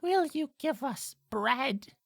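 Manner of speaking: high, slow bird voice; begging blackbird. Acted. Blackbird asking the player for bread, high and slow. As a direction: plead in a drawn-out creature voice.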